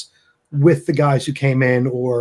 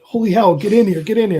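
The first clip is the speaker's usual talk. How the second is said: higher, eager welcome; excited doorman; 7 semitones higher, 4 dB louder than usual. Acted. Doorman hurries the celebrity party inside, excited. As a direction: usher them in excitedly.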